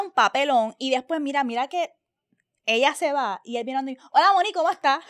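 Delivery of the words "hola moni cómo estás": higher, louder, chummy greeting in ordinary Puerto Rican Spanish; regular local Spanish. Retold or imitated. The actor greeting Moni in plain Puerto Rican Spanish. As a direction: drop the fake accent and say hi casually. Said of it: "Que hablando español regular".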